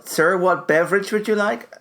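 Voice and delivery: formal mock service voice; mock waiter. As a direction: play a courteous waiter taking an order. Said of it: "I think you'll find it's pronounced".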